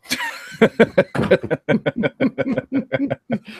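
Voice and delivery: a long high cackle that falls away; real laughter at his own joke. Real, not acted.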